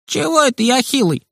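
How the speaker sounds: put-on high, offended boy's protest; indignant. A narrator's reading. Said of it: "возмутился тот из-за забора". (обиженно и возмущённо)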